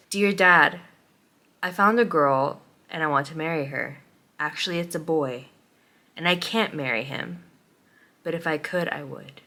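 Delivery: low, soft, tender confession; letter read aloud. Acted. Reader voicing a rewritten letter to Dad, soft confession. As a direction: read it quietly, pausing between sentences.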